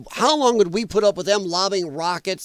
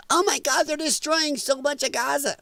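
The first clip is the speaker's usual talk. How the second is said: high sing-song parody of complainers; mocking whine; 7 semitones higher, 4 dB quieter than usual. Streamer mocks critics of Israel, high mocking whine. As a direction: mimic the hand-wringing critics, pitched up.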